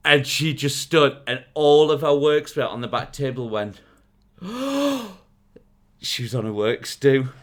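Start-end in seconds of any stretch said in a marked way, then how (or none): 4.4-5.1 high, imitated collective gasp; shocked gasp. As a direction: gasp like horrified onlookers. Mimics colleagues' shocked reaction.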